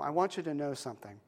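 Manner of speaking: soft, tender, unhurried confiding; gentle grandma. Acted. Grandma gently tells her grandson she saw everything, soft and tender. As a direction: lean in kindly and tell him.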